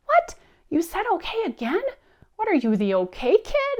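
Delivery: very high, playful mock disbelief; enthusiastic surprise. Mother reacting to son's okay, enthusiastic high-pitched amazement. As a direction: squeal it as if astonished, to delight a child. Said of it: "I react enthusiastically".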